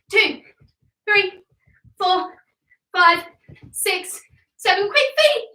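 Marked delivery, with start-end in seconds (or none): 4.9-5.4 quick coaching cry, pitched way up; high urging call. Real, not acted. Coach urging kids sprinting on the spot, high excited cry. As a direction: push them to move faster.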